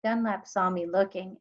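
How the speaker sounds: ordinary delivery, nothing marked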